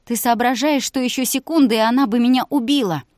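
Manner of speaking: high, loud hoarse shout. A narrator's reading. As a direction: yell it at him, outraged. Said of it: "Она сорвалась на крик". (громкий крик)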